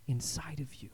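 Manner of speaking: breathy hushed whisper; soft whispered aside to the congregation. Acted. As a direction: drop to a whisper on the last words. Whispered close of a line.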